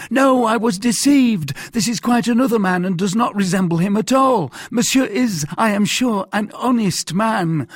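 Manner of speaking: high, loud, hurried protest; frantic high-pitched backpedalling; crying out. A narrator's reading. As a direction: take it all back in a fluster. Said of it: "cried Bonacieux".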